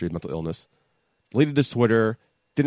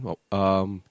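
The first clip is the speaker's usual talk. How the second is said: ordinary delivery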